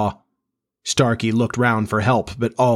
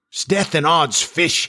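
slow, higher-pitched thunderous oath; thundering; 6 semitones higher, slower than usual. A narrator's reading. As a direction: roll out the oath like a furious captain. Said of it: "he thundered".

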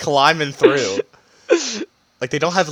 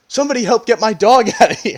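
high, loud damsel plea; mock plea for help; 8 semitones higher, 5 dB louder, faster than usual. Imitating the dog owner begging for rescue, mock plea. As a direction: call out for help, breaking into a laugh. Voices woman pleading for help.

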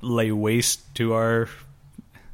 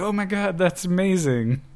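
imitated amazed onlookers; 7 semitones higher than usual. People watching a wizard do magic, imitated amazement. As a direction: gush like an imagined fan, pitched up. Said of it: "people see him doing magic and stuff".

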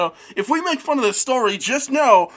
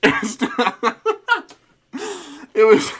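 ordinary talk, nothing marked

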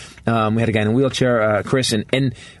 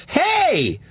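barista voice; 13 semitones higher, slower than usual. Retold or imitated. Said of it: "the barista goes".

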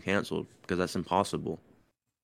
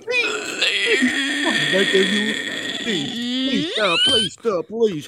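mock desperate begging; 9 dB louder, much slower than usual.

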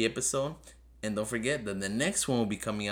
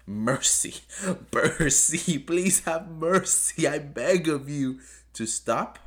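mock pleading; 6 semitones higher, 5 dB louder, much slower than usual.

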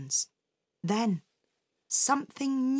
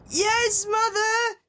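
high-pitched, sing-out reply; dutiful call back; 12 semitones higher, slower than usual. A narrator's reading. Little man calling back to his mother, high and dutiful. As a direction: answer obediently, pitched well up.

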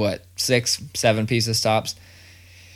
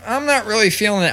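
put-on higher, picky kid voice; imitating his kid; 10 semitones higher, 3 dB louder than usual. Dad mimicking his son refusing cheese, higher picky voice. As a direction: turn your nose up like a fussy child. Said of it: "and he'd be like".

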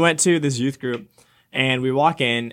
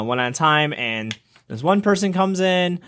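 usual speaking voice

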